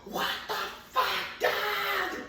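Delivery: high-pitched retold scream. Dad and dog fighting at breakfast, screaming. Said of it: "screaming at each other".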